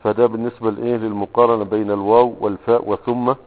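usual speaking voice

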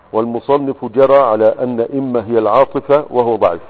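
loud, declaimed reading of a grammar text; formal text reading. Acted. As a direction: read the classical text out loudly. Reads the grammar text aloud. (قراءة المتن بصوت جهوري)

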